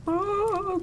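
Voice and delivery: sharp high yelp; sudden jump of fright; startled cry. Real, not acted. Fright while fleeing.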